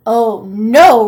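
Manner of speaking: loud alarmed shout. Acted. Troll shouting as the antlers sway. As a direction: yell it while holding on tight. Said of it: "Rollo shouted".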